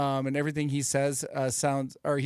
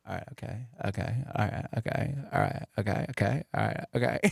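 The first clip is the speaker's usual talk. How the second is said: Matthew McConaughey impression; 5 semitones lower than usual. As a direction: murmur the phrases like an engine starting up. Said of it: "McConaughey always does this".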